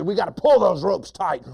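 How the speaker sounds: high, loud bossy order; mean hunter. Acted. Hunter ordering the ropes pulled, loud mean voice. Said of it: "The first hunter says".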